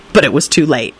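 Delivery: plain reading voice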